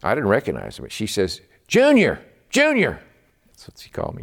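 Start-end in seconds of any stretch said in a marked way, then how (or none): 1.7-3.0 high, eager calling of his name; a sister bursting to share news; excited sister on the phone. Retold or imitated. His sister calling him in excitement, high and eager.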